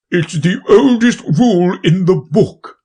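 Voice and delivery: booming king; pompous king, loud and grand. Acted. King replies.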